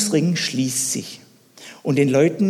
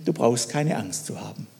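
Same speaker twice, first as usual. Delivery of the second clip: softer, lower calm reassurance; reassuring; 4 semitones lower, 7 dB quieter than usual. Acted. Elisa calms his frightened servant in a soft reassuring voice. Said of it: "Elisa beruhigte ihn".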